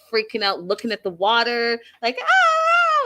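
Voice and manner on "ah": long, high imitated scream; loud hammed-up shriek; mock scream. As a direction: scream like someone terrified, for comic retelling. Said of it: "just screaming".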